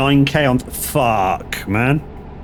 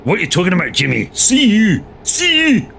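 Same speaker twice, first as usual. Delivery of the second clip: hammed-up Scottish, pitched higher, "See you" shouted up high; mock Scottish accent; 6 semitones higher than usual. Streamer answers chat's Scottish guess with a mock Scottish accent. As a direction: play a comic Glaswegian heckler.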